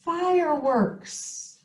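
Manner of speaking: wowed, elongated one-word exclamation; excited exclamation. Shown as a demonstration. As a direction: stretch it out like an exclamation point follows. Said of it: "they show you are excited".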